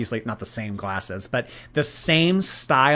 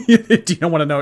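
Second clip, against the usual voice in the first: talking through real giggles, louder; laughing; 4 semitones higher, 6 dB louder than usual. Real, not acted. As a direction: let the laugh spill into the words. Amused by question about wallpaper.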